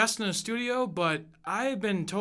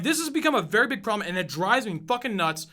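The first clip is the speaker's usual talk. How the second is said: ordinary talk, nothing marked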